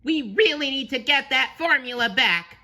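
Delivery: high, loud, urgent cartoon voice; SpongeBob crying out. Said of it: "he cried".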